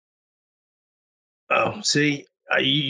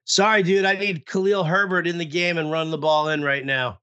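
usual speaking voice

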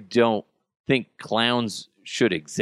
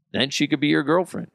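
usual speaking voice

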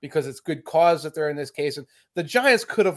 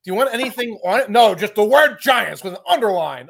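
louder, higher acted-out back-and-forth; mock coach exchange; 6 semitones higher, 6 dB louder than usual. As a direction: bark out the imagined helmet order. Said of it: "Parcells wrote it and said".

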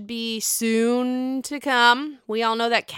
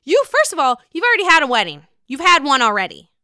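loud, high yell at Scheana; angry rant; 7 semitones higher, 7 dB louder than usual. Real, not acted. Vlogger yelling at Scheana through the screen, loud and furious. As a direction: tell her off at full volume.

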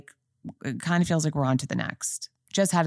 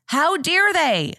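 high, loud indignant How dare they; outraged; 9 semitones higher, 4 dB louder, slower than usual. Real, not acted. Fan protesting the show killing off Big, indignant. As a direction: cry out in real indignation.

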